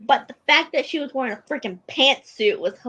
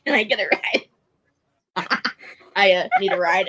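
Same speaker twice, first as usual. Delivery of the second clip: stuttering request; 2 semitones lower, 4 dB louder than usual. Retold or imitated. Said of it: "he kinda stuttered over it".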